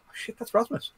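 high retold gasp of recognition; sudden realisation. Listener recalling when he recognised the actor, high surprised realisation.

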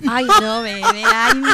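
high, loud laughing coo; laughing aww. Real, not acted. Host laughing tenderly at a mother's story of her daughter. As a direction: melt into a laugh at the cute story. Reacting to the child's remark.

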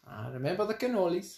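put-on a light Italian accent, barely there; half-hearted Italian accent. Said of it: "That's my Italian accent".

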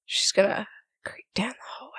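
hushed, creeping whisper; sneaky whisper. Pretend. Player narrates character sneaking down hallway.

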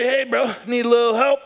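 high, loud, laughing hey bro; hammed-up eager greeting to a buddy; laughing excited call. Mock-friendly call to friend.

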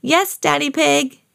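high, drawn-out eager reply; chorus back like keen children; everyone answering. A narrator's reading. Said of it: "everyone answered back".

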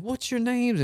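imitated eager question, pitched high; mock an impressed stranger's high voice; excited. Old couple asks golfers' names, high excited imitation. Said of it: "They got all excited and everything".

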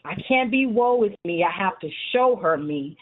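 ordinary talk, nothing marked

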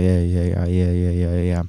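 low, monotone imitation of a friend; Danny's monotone voice. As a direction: drone it low in one tone. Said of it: "you have a monotone voice, like".

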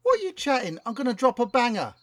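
lightly voiced London rapper, keen; a hint of a slangy London rapper; street rapper voice. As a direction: talk like a cocky MC pitching a hit. Plays rapper Chronic Cortex.

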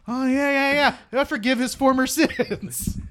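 high, eager forgiving; mock gushing. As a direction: gush it out, all on board. Mock forgiving crowd quote.

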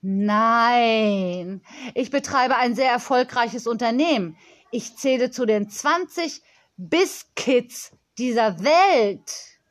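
put-on drawn-out, self-important child voice; proud girl, key words stretched; boastful young girl. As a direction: brag like a tiny company boss.

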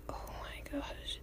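breathless whispered dismay; inner whisper. Retold or imitated. Overwhelmed fan with a headache, whispered. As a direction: whisper it under your breath. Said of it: "In my head".

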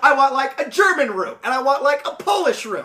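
high, loud imitation of demands; mock demanding. Podcaster mimicking the Sultan ordering themed rooms, mock demanding. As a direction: reel off a greedy wish list, hammed up.

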